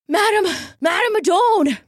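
high, breathless gasping call; out of breath from running, calling the soothsayer; gasped. Acted. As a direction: gasp her name out of breath. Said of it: "he gasped".

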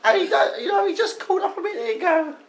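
high, put-on comic mum voice; Anne. Mother phoning the show about Dave, high falsetto. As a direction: play the caller's mum in falsetto. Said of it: "It's Dave's mum".

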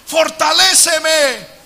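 loud pleading prayer, rising up; impassioned shouted plea; fervent cry for help. Acted. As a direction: cry out to the Lord for strength. Preacher voices fervent plea.

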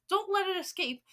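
high, urgent command; acted screech. Grand High Witch warning her witches, screeching. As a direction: order it with pitch jumping up. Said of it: "screeched the Grand High Witch".

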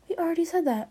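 whispered aside, unvoiced; hushed whispered repeat. Real, not acted. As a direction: breathe it out as a secret. Whispered repeat of own line.

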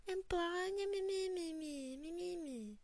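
high sing-song whining on nonsense syllables; mocking whine. Fed-up classmate parodying the president's complaints, mocking whine. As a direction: mimic a crybaby's whine, dismissively. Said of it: "listen to her whine".

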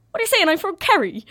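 high, loud Kerry accent, imitated; hammed-up Kerry accent; strong Kerry accent. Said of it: "speaking in this strong accent".

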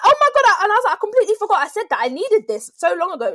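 high, excited retold exclamation; amazed. Daughter to mum on getting a forgotten wish, amazed. Retold delight at remembered gift.

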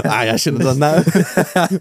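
slow, sheepish mock apology; a drawn-out guilty voice; mocking Marlon's regret; imitating sheepish friend.

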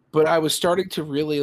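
usual speaking voice

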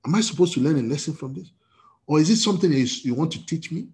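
ordinary talk, nothing marked

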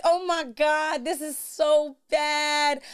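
high, slow dramatic dismay. Retold or imitated. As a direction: drag it out in dismay. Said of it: "I'm like".

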